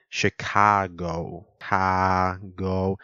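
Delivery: Chicago with a long, drawn-out AH, very slow; stretched vowels. Shown as a demonstration. Showing listeners the Chicago accent, vowels stretched. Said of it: "the vowels are very stretched out".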